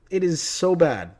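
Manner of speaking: usual speaking voice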